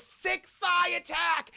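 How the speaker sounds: slow, high-pitched battle cry; mock attack cry. Mel springs on his torturer, mock attack cry. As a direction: call out a finishing move, hammed up. Mock announces Mel's finishing move.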